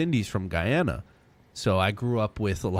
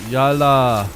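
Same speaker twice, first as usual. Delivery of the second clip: Arabic accent, loud; 3 semitones higher, 8 dB louder than usual. Shown as a demonstration. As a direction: belt Yalla like an Arabic speaker. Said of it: "I told you I'm good at accent".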